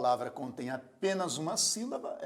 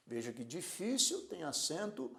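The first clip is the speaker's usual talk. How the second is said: ordinary talk, nothing marked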